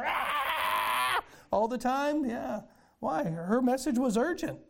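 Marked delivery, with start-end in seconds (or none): 0.0-1.2 mocking a candidate's shrill campaign scream. Preacher imitating a screaming candidate, mock shrill scream. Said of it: "That shrill".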